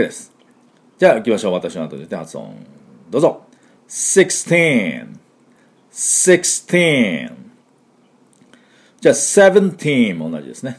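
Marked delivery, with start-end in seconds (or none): 3.9-7.3 put-on "sixteen" with TEEN held long and falling; stretched TEEN. Shown as a demonstration. Teacher leading repeat-after-me, sixteen with a long TEEN. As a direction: draw out the teen in "sixteen" for learners. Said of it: "teenをしっかり伸ばす".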